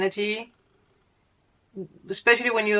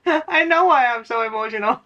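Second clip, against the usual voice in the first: said through a laugh, pitched up; laughing, knowing; 3 semitones higher, 4 dB louder than usual. Real, not acted. Reader answering her own mock viewer, laughing. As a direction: laugh as you admit you know.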